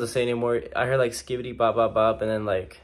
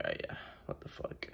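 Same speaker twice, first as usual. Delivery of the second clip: whispered disbelief under the breath; 18 dB quieter than usual. Retold or imitated. Reacting to kids slapping each other, whispered. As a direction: whisper it to yourself, weirded out.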